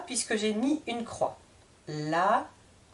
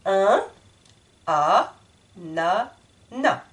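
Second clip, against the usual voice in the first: "ananas" broken into slow separate syllables, no S; syllabified; 3 semitones lower, 5 dB louder, much slower than usual. Shown as a demonstration. Teacher splits the word into syllables for the class. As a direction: chop "ananas" into beats. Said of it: "je répète le mot".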